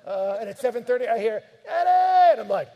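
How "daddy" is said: put-on high, loud call of a small child. Kids calling their father from upstairs, high loud call. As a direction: shout it up the stairs like a kid wanting dad.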